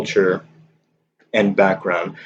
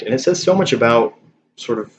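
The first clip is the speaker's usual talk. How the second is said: ordinary talk, nothing marked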